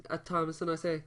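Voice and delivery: ordinary talk, nothing marked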